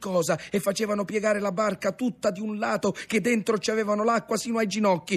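ordinary delivery, nothing marked

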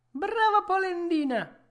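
vocina acutissima e forte, misteriosa; vocina gridata. A narrator's reading. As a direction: grida con una vocetta altissima da esserino. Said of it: "gridò la solita vocina". (vocina stridula che grida)

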